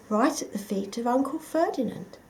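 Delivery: plain reading voice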